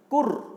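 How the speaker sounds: "kur" called out high and loud, rolled R; sounding out a syllable. Shown as a demonstration. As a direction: stress the syllable "kur" clearly for pupils. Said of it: "Cara membacanya". (suku kata "kur" diucapkan keras)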